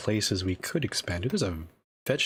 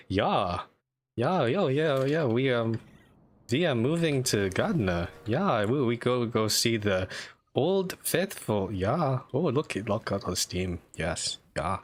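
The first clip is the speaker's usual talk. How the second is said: mock German accent; 4 semitones higher, slower than usual. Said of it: "German accent you can definitely make fun of".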